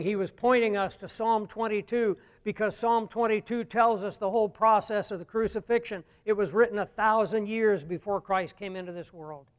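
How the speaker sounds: ordinary talk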